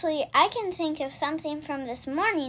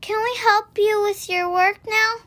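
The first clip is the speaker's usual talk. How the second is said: higher, louder eager offer; eager little rabbits; 7 semitones higher, 5 dB louder than usual. A narrator's reading. Rabbits now offer mama help, high and eager. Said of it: "cried the little rabbits".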